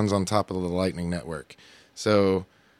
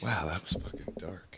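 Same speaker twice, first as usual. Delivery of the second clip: whisper; 10 dB quieter than usual. Real, not acted. Whispering after dark joke.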